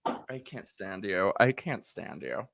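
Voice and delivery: mock disdain. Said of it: "mock annoyance".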